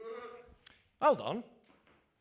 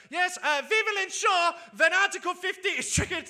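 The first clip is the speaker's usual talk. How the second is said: high-pitched mock German accent; Angela Merkel; 10 semitones higher, 5 dB louder than usual. Comedian imitating the chancellor addressing parliament, mock German accent. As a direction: put on a higher, comic German politician's accent. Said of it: "That's how she sounds".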